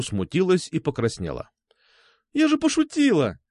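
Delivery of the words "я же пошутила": put-on high girlish cry of protest; protesting exclamation. A narrator's reading. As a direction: exclaim it high, flustered by the joke. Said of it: "воскликнула она". (смущённо-возмущённый возглас)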